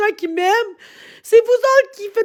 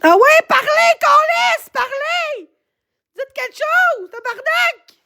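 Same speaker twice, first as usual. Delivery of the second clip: higher, pushing, daring them to answer; angry taunt; 6 semitones higher, slower than usual. Real, not acted. Streamer daring silent chat to talk, angry taunt. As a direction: dare the chat to speak up.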